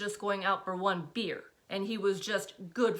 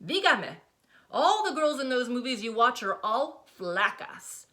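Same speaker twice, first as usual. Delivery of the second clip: flacas hit hard, higher and louder; emphasis on flacas; 5 semitones higher, 6 dB louder than usual. A narrator's reading. Mother stressing "flacas" to daughter, cutting. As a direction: lean on "flacas" as a jab. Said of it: "She puts particular emphasis on the last word".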